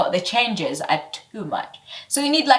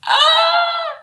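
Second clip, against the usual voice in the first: very high loud shriek of frustration; short high shriek; frustrated shriek; 6 dB louder than usual. Real, not acted. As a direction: let out a sharp shriek. Frustrated outburst.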